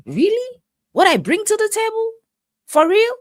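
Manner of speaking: high-pitched incredulous questioning. Retold or imitated. Acting her reaction to the insult.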